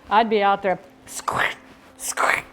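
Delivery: hushed squirt sound; mock spraying pests; imitating a spray bottle; whispered spray noise. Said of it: "squirt spraying critters".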